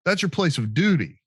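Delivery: a hint of a breezy army recruiter; recruiter's voice. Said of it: "And the recruiter's like".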